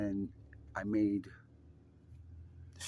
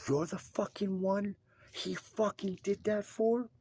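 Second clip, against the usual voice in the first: high, drawn-out, incredulous outburst; adamantly upset; 12 semitones higher than usual. Retold or imitated. Widow confronting the man her husband helped, high upset disbelief. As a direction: imitate an upset widow, pitched way up and slowed. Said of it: "they were so adamantly upset".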